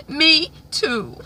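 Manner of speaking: acted tearful words dissolving into sobs; high-pitched pretend weeping; fake crying. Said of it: "She think I'm crying for real".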